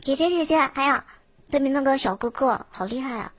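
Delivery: put-on high, sweet girlish voice; cute girl voice. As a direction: play the cute sister gushing. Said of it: "我们以姐妹相称嘛".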